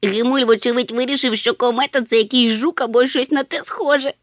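high, loud, amused chatter; cheered up. A narrator's reading. Chmykh laughing off Hemul's mistake, cheered up. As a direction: pipe up gleefully. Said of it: "повеселів Чмих".

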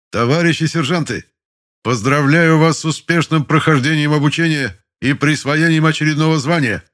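high, loud parade congratulation; loud formal address. A narrator's reading. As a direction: address the whole formation loudly. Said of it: "поздравил начальник школы".

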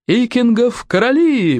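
high, drawn-out acclamation; rallying cry. A narrator's reading. Young warrior acclaims his new king, high drawn-out cry. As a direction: cry it out slowly, as a rallying call. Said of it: "вскричал бандюк".

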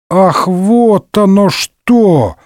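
high, slow drawn-out "oh"; dawning realization. A narrator's reading. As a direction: stretch it as the truth sinks in. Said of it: "допёрло до Д'Артаньяна". (протяжно, с запоздалым пониманием)

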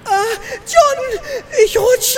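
hammed-up scream of fear; panicked shriek. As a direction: shriek for help while slipping off the cliff. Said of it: "Jetzt hör doch mal auf zu kreischen".

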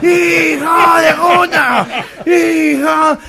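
grito nasal y alargado; multitud de gangosos. Acted. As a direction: corea lento, imitando a los gangosos. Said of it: "todos como cinco mil gangosos". (ovación gangosa arrastrada)

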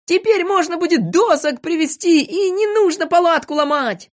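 high, excited cry of relief; joyful shout. A narrator's reading. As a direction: cheer the good news. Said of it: "закричали все радостно".

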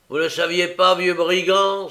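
loud high angry cry; furious loud outburst; exclaimed. A narrator's reading. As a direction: shout at the old thief. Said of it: "s'écria Sikes".

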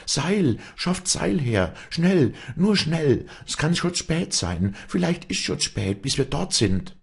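very high, pressing calls; frantic urging. A narrator's reading. Walli demands ropes from villagers, crying high and frantic. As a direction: drive the rescuers on urgently, pitched high.

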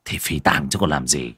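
low, soft, grumpy retort; surly. A narrator's reading. Husband answering wife's nagging, surly low grumble. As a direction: grumble it irritably, low in the throat. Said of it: "Ông Suy cau có".

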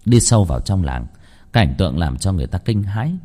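plain reading voice

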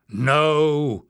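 loud, drawn-out no; protesting. A narrator's reading. Bada protests Leif's decision, forcefully. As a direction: object forcefully on one word. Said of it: "Bada protested".

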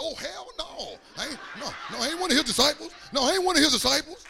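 high, fast mock-indignant denial; indignant cussing denial. Peter denying Jesus to his accusers, mock-indignant cussing. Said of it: "He, he started cussing".

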